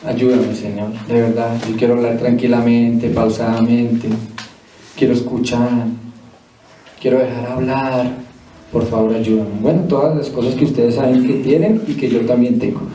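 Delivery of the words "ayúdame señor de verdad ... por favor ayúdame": humble prayer. Shown as a demonstration. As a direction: pray it humbly, asking for help. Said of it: "Tenemos que decirle, "Señor". (oración humilde y suplicante, en voz baja)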